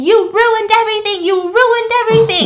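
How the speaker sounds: high, loud enraged shriek; furious scream. Retold or imitated. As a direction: scream it while attacking. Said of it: "Sadie starts attacking him".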